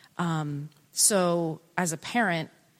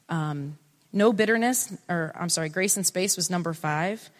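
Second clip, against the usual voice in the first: usual speaking voice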